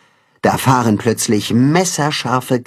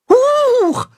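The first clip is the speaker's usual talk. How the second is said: startled squeak; 3 dB louder than usual. A narrator's reading. Tacho startled by jump.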